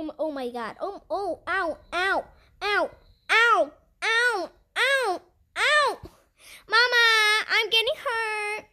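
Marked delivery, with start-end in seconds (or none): 1.5-6.0 repeated rising "ow" yelps; mock pain cries. Game character keeps getting hurt. 6.7-8.6 high, whiny call for mama; mock hurt wail. Hurt character calling to mama, mock wail.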